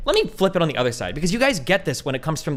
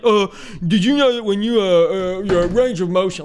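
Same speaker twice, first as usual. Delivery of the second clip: louder, higher know-it-all gym bro, fumbling; mock gym bro; 5 semitones higher, 6 dB louder, slower than usual. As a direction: lecture like an unwanted gym advisor. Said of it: "walking up to you be like".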